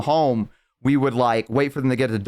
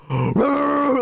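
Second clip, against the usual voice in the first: sharp intake, then high growly bark; rabid dog bark; 13 semitones higher than usual. Retold or imitated. Barking at the door to prank a roommate, high dog noise. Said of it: "barked like a rabid dog".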